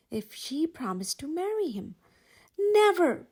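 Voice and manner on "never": high, loud flat refusal; exclaimed refusal. A narrator's reading. Belle refusing Gaston's marriage offer, loud and high. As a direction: spit back a firm refusal. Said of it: "Belle exclaimed".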